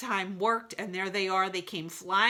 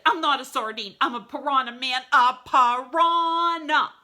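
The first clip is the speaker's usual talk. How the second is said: loud, higher protest; indignant piranha; 6 semitones higher, 11 dB louder than usual. A narrator's reading. Mister Piranha corrects the snake, loud indignant outburst.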